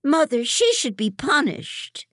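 high, loud tattling outburst; crying out. A narrator's reading. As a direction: cry out to get her punished. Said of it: "Hannah cries".

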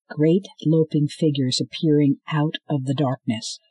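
ordinary delivery, nothing marked

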